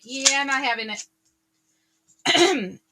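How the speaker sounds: slow, drawn-out brush-off; dismissive. Retold or imitated. Viewer brushing off drama, slow and dismissive. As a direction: wave it off, unbothered and slow. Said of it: "you're just like".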